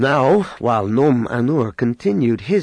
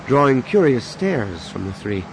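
usual speaking voice

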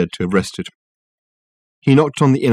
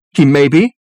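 higher, louder, haughty reply; acted huff, pitched up; huffy secretary; 6 semitones higher, 7 dB louder than usual. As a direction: toss it out huffily. Huffy secretary answering the visitor.

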